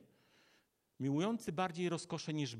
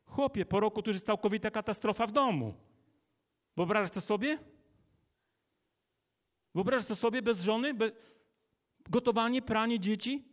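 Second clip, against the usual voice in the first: higher-pitched, hurried exhortation; impassioned preaching; 4 semitones higher, 4 dB louder, faster than usual. Real, not acted. Preacher asking men to imagine no wife, impassioned. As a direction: drive it home with rising urgency.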